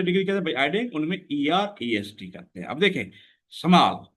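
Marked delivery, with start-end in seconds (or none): 3.5-4.1 small in one loud, unbroken beat; one syllable, unbroken. Shown as a demonstration. Teacher showing students one syllable, small in one go. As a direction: say small without stopping inside it. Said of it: "हम रुके नहीं हैं".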